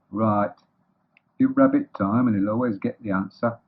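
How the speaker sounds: deadpan quizmaster, scornful of the listener; sarcastic Eeyore. Acted. Eeyore giving Rabbit three guesses, dry sarcasm.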